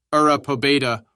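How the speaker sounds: loud but restrained war cry; understated battle cry; roared war cry. A narrator's reading. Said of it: "roared closer and closer".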